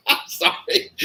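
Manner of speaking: breaking up in high-pitched laughter; can't keep it together, laughing high. Real, not acted. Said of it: "cracks up mid-read".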